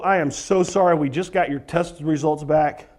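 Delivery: mock somber doctor; grave bad news. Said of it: "walk into someone's room to go".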